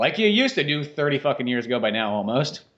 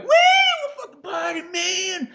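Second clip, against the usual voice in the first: [name]; 3 dB louder than usual. Retold or imitated. Singer hyping the reality show house, party squeal. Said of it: "and [name]'s like".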